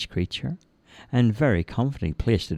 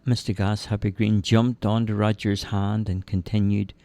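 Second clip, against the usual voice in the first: plain reading voice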